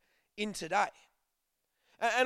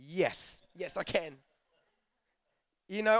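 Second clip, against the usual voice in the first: ordinary talk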